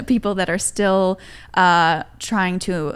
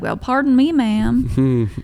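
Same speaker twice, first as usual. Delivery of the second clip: louder, higher mock cowboy Southern accent; 3 semitones higher, 5 dB louder, slower than usual. Guest retells comedy bit, mock Southern cowboy accent. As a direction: imitate a polite Southern cowboy. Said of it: "he's like".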